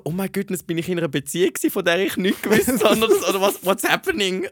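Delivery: high, loud, rushed inner rant; hammed-up flustered spiral of questions; mock panic. Stranger's arm suddenly round her, mock panic.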